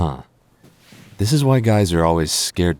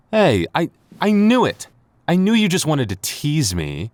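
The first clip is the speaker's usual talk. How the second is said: flustered outburst; 11 semitones higher than usual. Real, not acted. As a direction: blurt it, caught red-handed.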